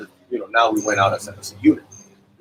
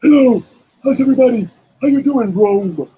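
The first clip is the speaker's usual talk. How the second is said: higher, louder showman voice greeting the crowd; 13 semitones higher, 6 dB louder than usual. Retold or imitated. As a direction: ham up a bandleader's big hello. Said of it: "He goes".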